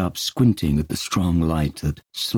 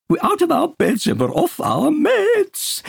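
high, excited patient voice; bubbling high mania; giddy Peter; 4 dB louder than usual. Acted. Peter chanting to Dunbar, high and giddy.

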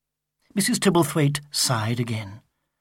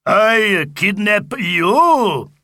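higher, louder, very slow Viking; crying Viking; 6 semitones higher, 6 dB louder, much slower than usual. Acted. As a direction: bellow it like a proud Viking. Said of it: "he cried".

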